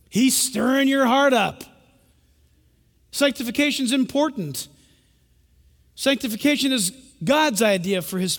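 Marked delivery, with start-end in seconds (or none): none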